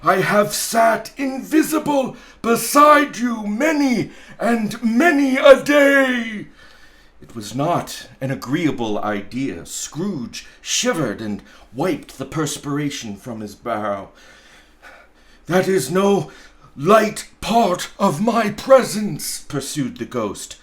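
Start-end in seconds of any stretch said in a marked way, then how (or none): 0.0-6.5 booming ghost. Acted. Marley's ghost warning Scrooge, loud and wailing. 15.4-19.4 booming ghost. Acted. Marley's ghost warning Scrooge, loud and wailing.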